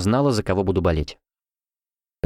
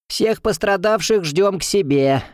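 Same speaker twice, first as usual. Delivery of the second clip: slow, high teasing threat; mock growl; 9 semitones higher, slower than usual. A narrator's reading. Sister teases students before the race, slow and high. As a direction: drawl the threat out slowly. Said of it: "рыкнула одна из сестёр Минея".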